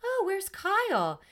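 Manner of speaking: high, slow, innocent mock question; lightly voiced castmate asking sweetly; imitating Garcelle. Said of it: "Garcelle goes".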